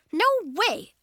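high, loud objection; outraged protest; indignant refusal. A narrator's reading. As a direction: refuse it outright. Girl refuses to share her closet.

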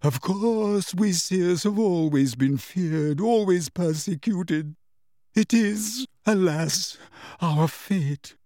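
grandly theatrical self-pity; dramatic, wavering. A narrator's reading. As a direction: intone mystically as a persecuted prophetess. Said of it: "dramatic, wavering voice".

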